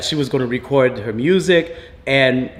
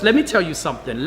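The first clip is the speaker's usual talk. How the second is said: ordinary talk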